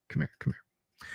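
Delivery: unvoiced whisper, beckoning close. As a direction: whisper come here.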